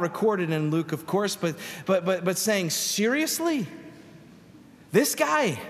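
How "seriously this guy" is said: pitched way up in disbelief; can't believe God means him; incredulous. Acted. Ananias balking at God's command, incredulous.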